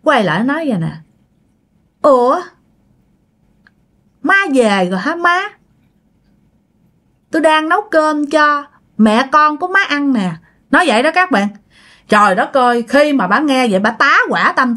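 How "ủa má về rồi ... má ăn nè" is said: higher-pitched, lightly acted greeting with pauses; lightly voiced pig-woman daughter-in-law. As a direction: greet the mother-in-law in a slightly put-on higher voice. (giọng con dâu heo, hơi cao)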